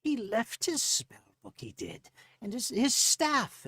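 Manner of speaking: high-pitched gnome voice, drawn out. Acted. Gnome telling of the spell book left behind, high and drawn out.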